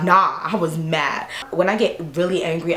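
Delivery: normal talking voice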